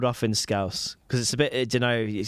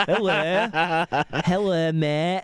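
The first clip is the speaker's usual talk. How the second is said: higher-pitched put-on Hull greeting; Hull accent; 5 semitones higher, slower than usual. Host showing co-host the sexiest accent, mock Hull. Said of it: "Hull is the sexiest accent".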